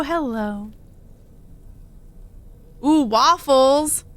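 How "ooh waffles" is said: delighted. Real, not acted. Slow delighted ooh.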